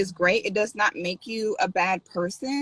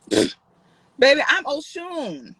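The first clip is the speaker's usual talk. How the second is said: playful diva boast; 6 semitones higher, much slower than usual. Real, not acted. Streamer playfully claiming to be Oshun, sassy. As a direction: flaunt it like a goddess.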